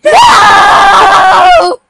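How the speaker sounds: huge high laughing yell; laughing shout. Real, not acted. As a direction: scream no while laughing.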